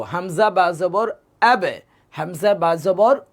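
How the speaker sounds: slow Hamza বা জবর আবে with an echoing ব; slow, spell it out and bounce the ending; qalqala style. Shown as a demonstration. Teacher demonstrating qalqala to the class, slow and echoing. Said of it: "এই কলকলার স্টাইলে আমরা পড়বো".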